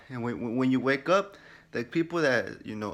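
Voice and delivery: ordinary talk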